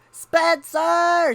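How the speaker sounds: high, loud yell up the stairs; exasperated calling-out. Acted. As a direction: yell the name like a fed-up mom. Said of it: "she yelled on her way upstairs".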